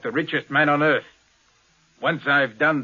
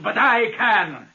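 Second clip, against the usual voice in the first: higher, slow, savouring each word; slow boast; 7 semitones higher, slower than usual. Acted. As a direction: gloat that only he can do it. Boasting he can reach it.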